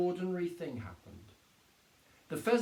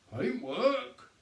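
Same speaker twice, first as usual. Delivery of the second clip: sergeant feigning puzzlement; 8 semitones higher, 4 dB louder than usual. A narrator's reading. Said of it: "Sergeant Samways said".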